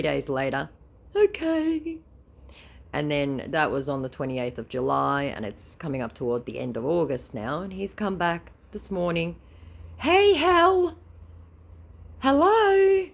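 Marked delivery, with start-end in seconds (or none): 1.1-2.0 high put-on scammer okay. Scammer coming back after three days, high mock voice. 10.0-13.1 high, loud sing-song scammer greeting. Acted. Scammer returning one morning, high loud mock greeting.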